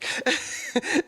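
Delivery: brief high-pitched laugh; small amused chuckle. Real, not acted. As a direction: laugh at your own admission. Joking about her own exaggeration.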